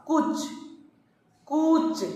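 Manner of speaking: कुछ said clearly twice, with a short u; pronunciation demo. Shown as a demonstration. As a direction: sound out कुछ for the class. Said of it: "उच्चारण करके देखेंगे".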